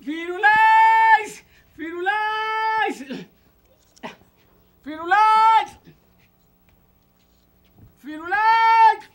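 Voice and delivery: high, drawn-out summoning shout; calling out. Acted. Master calling the dog, high long call. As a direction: stretch the name out loudly.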